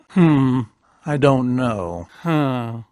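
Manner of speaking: normal talking voice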